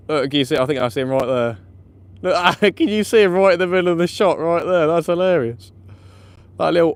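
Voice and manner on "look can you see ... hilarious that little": laughing. Real, not acted. Laughing at rat on camera.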